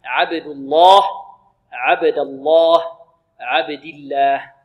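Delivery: الله said with a full, thick lam; teacher stretches the heavy lam of الله slowly; heavy lam in Allah. Shown as a demonstration. Teacher demonstrating to students, thick lam in الله. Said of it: "هكذا نقول".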